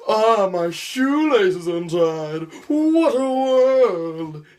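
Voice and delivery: high, slow, loud tragic wail; melodramatic thespian. Retold or imitated. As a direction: mourn a trivial thing like grand tragedy. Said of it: "people who do theater".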